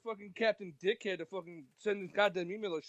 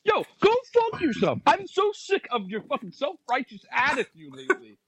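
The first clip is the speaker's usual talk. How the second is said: slow, loud, high-pitched fake tantrum; mock angry rant; 6 semitones higher, 7 dB louder than usual. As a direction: blow up in a hammed-up rage. Mock blowup at draft commissioner.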